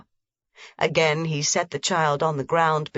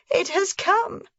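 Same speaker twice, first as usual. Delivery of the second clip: put-on much higher, frightened old woman; frightened cry; 14 semitones higher than usual. A narrator's reading. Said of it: "she cried".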